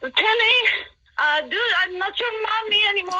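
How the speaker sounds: put-on high-pitched, uncertain French accent; attempted French accent. Caller banishing ghost on request, tentative French accent. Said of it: "in a French accent say".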